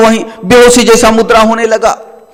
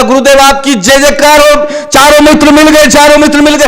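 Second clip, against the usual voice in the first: overjoyed; 5 semitones higher than usual. Acted. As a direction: celebrate finding the lost friend. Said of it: "खुशी का ठिकाना नहीं रहा". (खुशी से ऊँची आवाज़ में जयकार)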